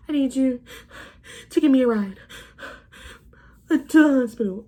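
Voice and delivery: mimicking the ex pleading for a ride; mock desperate plea. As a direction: ham up a desperate whiny demand. Ex begging her for a ride.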